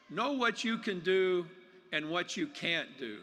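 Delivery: ordinary talk, nothing marked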